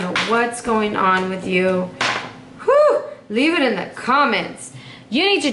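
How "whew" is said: very high whooping "whew"; exclaimed whew. Real, not acted. Reader reacting to the tense cards, high-pitched whew. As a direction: let out a sharp, high whew.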